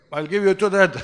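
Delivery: loud, high outburst at his son; acted explosion of a father's anger; angry father. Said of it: "He was angry at the boy".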